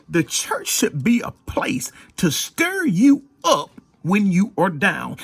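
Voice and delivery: slow, rising preacher's call; preaching. Acted. Preacher telling church it should stir people, slow preaching. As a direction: lift it slowly to the congregation.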